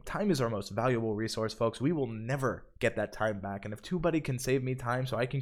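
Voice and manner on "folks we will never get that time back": ordinary talk, nothing marked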